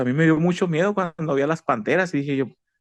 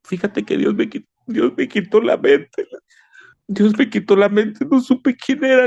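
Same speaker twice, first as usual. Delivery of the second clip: high, tearful plea, voice near breaking; crying to his wife; 7 semitones higher than usual. Retold or imitated. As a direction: tell his wife in tears what happened. (llorando con voz aguda y quebrada)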